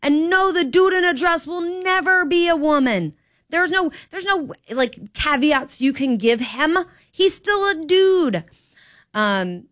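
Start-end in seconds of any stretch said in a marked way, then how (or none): 0.0-8.4 raised, higher ranting voice, slowing to hammer the end; heated rant. Real, not acted. Talk radio host ranting to listeners, raised and heated.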